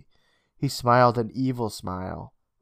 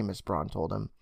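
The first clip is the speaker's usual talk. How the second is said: ordinary delivery, nothing marked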